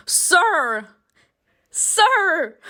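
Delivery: high-pitched exclamation; scandalized, high "Sir!"; indignant protest. Real, not acted. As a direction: call him out in high disbelief. Protesting at the father's demand.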